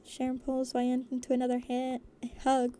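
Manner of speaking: ordinary delivery, nothing marked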